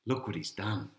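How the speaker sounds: plain reading voice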